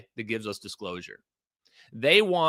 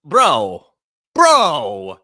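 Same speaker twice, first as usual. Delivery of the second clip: loud, slow, stunned question, then calling bro; stunned disbelief; 10 dB louder, much slower than usual. Real, not acted. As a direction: blurt it out in shock at the news. Stunned reaction to UFO news.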